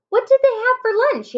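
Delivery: high, quick interjection; breaking in. A narrator's reading. Annie interrupts Jack's reading about lions, high quick interjection. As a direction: cut in high and fast with a question. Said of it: "Annie broke in".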